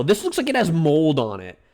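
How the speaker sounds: normal talking voice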